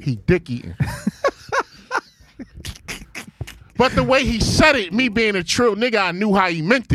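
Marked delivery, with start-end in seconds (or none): 0.8-1.6 laughing hard at the line; high breathy laugh. Real, not acted. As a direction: burst out in a high airless laugh. Cracks up at son's line.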